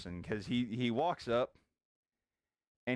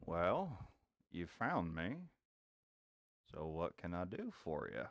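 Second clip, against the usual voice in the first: lightly voiced old Western cowboy, low and drawling; gruff cowboy NPC; 6 semitones lower, 7 dB quieter than usual. Old cowboy tells the noisy party to pipe down, lightly voiced. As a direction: warn the rowdy newcomers off, a cowboy low and steady.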